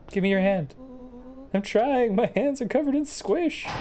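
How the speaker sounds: high, urgent voice; frantic, pitch climbing high; panicked. Acted. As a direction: scramble in a hurry to hide. Hiding as someone comes.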